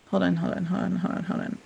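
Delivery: soft, repetitive aside to oneself; distracted muttering. Real, not acted. Teacher who lost the train of thought, muttering quietly.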